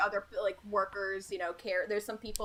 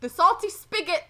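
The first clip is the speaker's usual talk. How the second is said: put-on drunk local; 8 semitones higher, 7 dB louder than usual. Drunk NPC voice.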